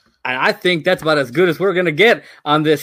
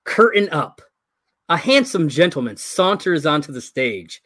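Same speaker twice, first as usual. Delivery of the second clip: ordinary delivery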